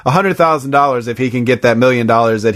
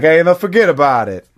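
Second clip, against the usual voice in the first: lightly imitated New York driver; New York accent; 3 semitones higher than usual. Repeating the limo driver's brush-off, New York accent. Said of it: "like, from New York".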